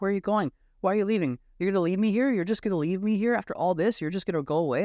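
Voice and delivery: high-pitched imitation of a distraught partner; re-enacted panicky pleading, pitched high; freaking out. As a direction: plead in rising disbelief at being left. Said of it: "she's, like, freaking out".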